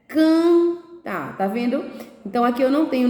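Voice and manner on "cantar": CANTAR split in two, first nasal syllable held high; syllable demonstration. Shown as a demonstration. Teacher shows pupils the nasal syllable, drawn-out demonstration. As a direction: stretch the nasal vowel in cantar, then pause. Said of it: "Então, vai ser, ó".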